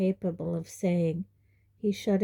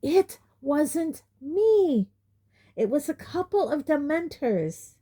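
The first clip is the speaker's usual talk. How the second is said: high, loud and slow, anger climbing; rising temper; 9 semitones higher, 4 dB louder than usual. Acted. Harry losing his temper with his uncle, voice rising high and loud. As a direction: let the temper and voice rise together. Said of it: "temper and voice both rising".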